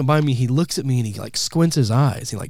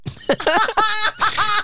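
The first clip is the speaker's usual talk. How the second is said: squeaky embarrassed laugh; high laugh. Real, not acted.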